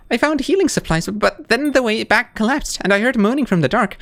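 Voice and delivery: grateful small bug, a touch shaken; Sherma's relieved voice. Acted. Sherma tells Hornet how she got trapped, high and relieved.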